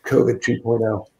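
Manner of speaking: hoarse, groggy sick voice. Real, not acted. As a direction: speak through a lingering cold, groggy. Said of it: "the groggy voice".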